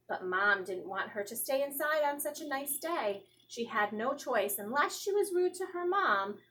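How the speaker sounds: ordinary delivery, nothing marked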